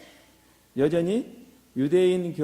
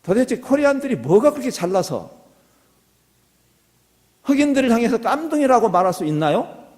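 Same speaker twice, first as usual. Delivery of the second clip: raised, louder preaching in reproach; indignant rebuke; 7 semitones higher, 7 dB louder than usual. Real, not acted. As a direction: challenge the congregation with real indignation. Preacher rebukes congregation over racist slur. (목소리를 높여 꾸짖듯이)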